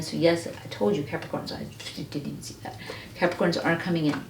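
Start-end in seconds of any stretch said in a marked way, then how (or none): 1.0-2.8 hushed whisper to herself; whispered aside mid-reading. Real, not acted. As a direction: drop to a breathy whisper, as if to herself.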